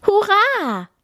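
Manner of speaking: high, drawn-out cheer; jubilant cry. A narrator's reading. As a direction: cheer out as the little deer. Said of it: "rief das kleine Reh". (jubelnd gerufen)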